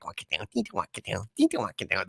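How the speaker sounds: muttered cowboy, soft and fast; quick, low-volume mock John Wayne; a mumbled hint of John Wayne. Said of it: "do John Wayne like".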